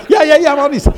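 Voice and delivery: high, loud excited reply; acted-out frantic agreement, very high; frantic shouting. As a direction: yell back eagerly over the phone. Acts frantic reply to screamed order.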